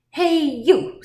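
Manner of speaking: loud, high hail; grouchy challenge. A narrator's reading. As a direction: call out like a scrappy ladybug. Grouchy ladybug accosts bigger animals.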